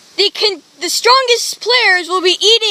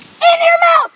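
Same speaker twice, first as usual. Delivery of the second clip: shouted; 8 semitones higher, 6 dB louder than usual. Real, not acted. Shouting at contestant.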